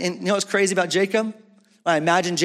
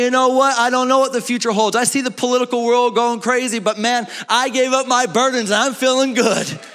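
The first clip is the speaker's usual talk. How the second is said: rising, louder joyful testimony; carefree believer; 5 semitones higher, 4 dB louder than usual. Acted. Preacher voicing a free believer, bright and loud. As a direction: sound unburdened and glad.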